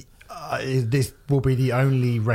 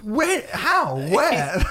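mock bafflement; 13 semitones higher, much slower than usual. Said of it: "It's like".

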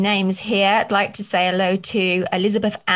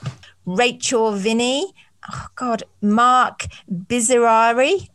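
normal talking voice

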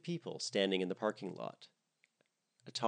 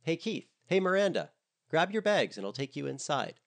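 high, loud call for attention; 8 semitones higher, 5 dB louder than usual. A narrator's reading. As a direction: call them over brightly. Barbara calls the distracted couple.

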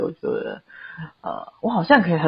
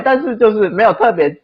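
usual speaking voice

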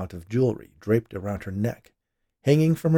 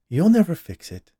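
matter-of-fact; 9 semitones higher, 7 dB louder than usual. A narrator's reading. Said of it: "Dolores said matter-of-factly".

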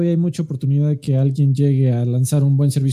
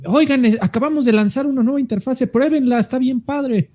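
upbeat, higher announcer voice; cheerful announcement; 7 semitones higher than usual. Retold or imitated. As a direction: sell it like a happy corporate update. Cita el anuncio del moderador. (anuncio alegre y entusiasta)

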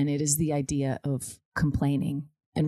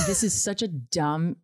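usual speaking voice

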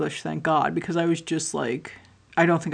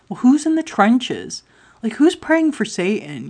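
higher, louder, incredulous questioning; incredulous challenge; 6 semitones higher, 6 dB louder than usual. Real, not acted. Speaker challenging the spiritual community, incredulous. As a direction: throw the question out high and loud.

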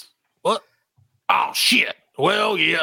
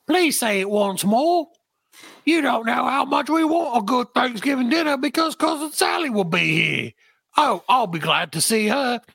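put-on much higher, sing-song young woman teasing; high girlish voice; 8 semitones higher than usual. Ada mocking grandfather about being thankful, high teasing girl's voice. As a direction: tease grandpa sweetly, pitched up high.

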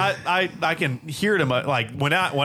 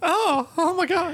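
high, slow flustered "oh my God"; girlfriend's mock gasp; slower than usual. Girlfriend hearing her name announced, swooning high. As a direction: act giddy and flattered, drawn out. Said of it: "and she's like".

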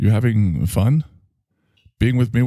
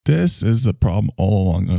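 ordinary talk, nothing marked

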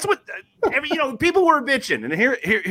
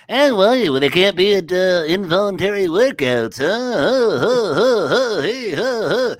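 lightly voiced mocking nerd; mock the whiners in a nerdy voice; 3 semitones higher, slower than usual. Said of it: "I didn't know you had that voice in you".

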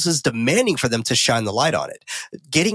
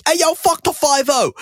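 high, loud taunting yell; 5 dB louder than usual. Acted. Pest heckling police from the bridge, yelling. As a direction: holler it out at full pitch. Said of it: "Pest yelling".

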